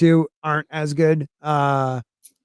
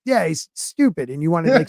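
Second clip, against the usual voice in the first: normal talking voice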